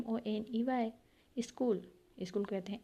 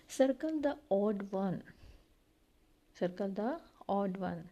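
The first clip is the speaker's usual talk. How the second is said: slow reading; much slower than usual. Shown as a demonstration. Teacher reads an instruction aloud.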